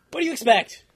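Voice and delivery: loud, high-pitched exasperation; exasperated retort. Retold or imitated. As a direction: burst out at the unreasonable customer. Retort to pissed-off customers.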